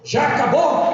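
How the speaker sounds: high-pitched mock child's call; loud child. Little son calling out during silence at mass, loud. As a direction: blurt it out high, imitating a small boy. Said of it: "Ele falando alto assim".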